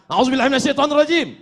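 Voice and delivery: fast, high, rushed recitation; angry rushed recitation. Shown as a demonstration. Preacher showing the angry way to seek refuge, rushed and high. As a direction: gabble it out in a temper. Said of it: "Kalau sedang marah".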